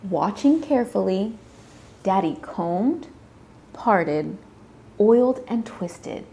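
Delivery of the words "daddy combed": usual speaking voice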